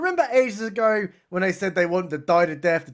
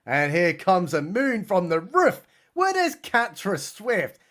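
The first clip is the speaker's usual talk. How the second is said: usual speaking voice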